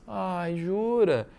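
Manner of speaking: soft, drawn-out mock disbelief; ironic intonation. Shown as a demonstration. Teacher shows how irony sounds in speech, ironic intonation. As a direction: stretch it out with obvious irony. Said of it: "aparece a entonação de voz".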